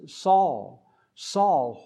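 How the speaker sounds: loud ringing call of the name; Saul, Saul, called out loud; calling from heaven. Retold or imitated. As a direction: call the name out from far above. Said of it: "When Jesus calls from heaven".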